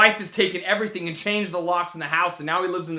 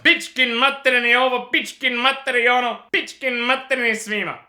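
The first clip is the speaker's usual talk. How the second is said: higher-pitched imitated name-calling; mock foreign cursing; 6 semitones higher, 3 dB louder than usual. Guy hurling unknown names at two friends, mock cursing. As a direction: reel off made-up foreign insults. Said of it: "calling me and Billy every name in the book".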